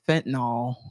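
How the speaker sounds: "fentanyl" said fent-nawl in mid-sentence; slang pronunciation. Shown as a demonstration. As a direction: slip in "fentanyl" as two syllables, fent-nawl. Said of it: "He, that's better".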